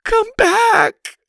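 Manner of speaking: very high, loud strained cry; hammed-up desperate call. A narrator's reading. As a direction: strain harder, crying out melodramatically. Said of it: "continued in her strained voice".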